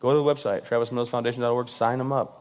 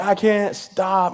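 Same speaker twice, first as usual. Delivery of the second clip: high, slow, hammed-up cry of alarm; stretched-out fake alarm; mock panic; 6 semitones higher, much slower than usual. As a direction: play the helpless runaway. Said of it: "And I'm like".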